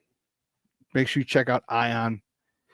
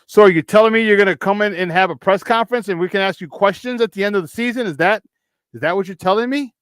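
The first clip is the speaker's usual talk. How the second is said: higher, sarcastic rhetorical questions, building disbelief; incredulous sarcasm; 8 semitones higher, 4 dB louder than usual. Real, not acted. Host mocking the owner's promise of answers, incredulous. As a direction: needle the owner with mock questions.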